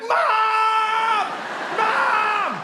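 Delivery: high calling shout; shouting for mom. Retold or imitated. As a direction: yell for mom from another room. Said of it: "I'd be like".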